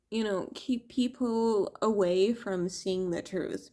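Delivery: ordinary talk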